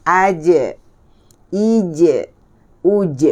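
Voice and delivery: "aj. Ij. Uj" with voiced bouncing j; true jim sound. Shown as a demonstration. Teacher corrects ach, slow voiced "aj. Ij. Uj". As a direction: voice the j and bounce it slightly. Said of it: "Dia bunyi jimnya".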